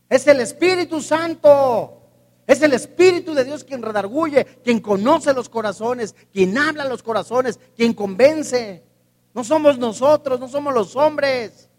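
loud, emphatic preaching; fiery preaching. Acted. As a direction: drive the truth home from the pulpit. Preaching at full force. (predicación enérgica y fuerte)

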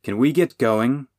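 loud, impatient demand. A narrator's reading. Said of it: "Will demanded".

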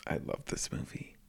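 soft breathy whisper. Real, not acted. Whispered then repeated.

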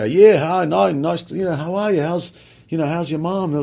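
lightly put-on Australian accent. Shown as a demonstration. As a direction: slip into an Aussie accent. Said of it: "I just go".

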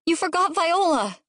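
put-on high-pitched kid's voice; small boy piping up; alerting child. A narrator's reading. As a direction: tell Mom what she forgot. Child alerts mother to missing baby.

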